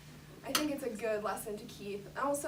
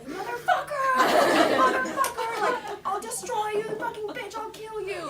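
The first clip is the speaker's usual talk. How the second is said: much higher, much louder mock scream with laughter; imitating a scream; 12 semitones higher, 11 dB louder than usual. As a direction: shriek it like the mom mid-fight. Mom screaming during a fistfight.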